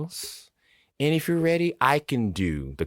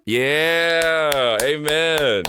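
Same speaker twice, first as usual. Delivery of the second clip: cheering; 7 semitones higher, 4 dB louder, much slower than usual. Real, not acted. Host cheers the correct answer.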